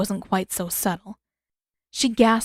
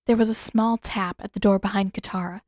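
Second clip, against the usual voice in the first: usual speaking voice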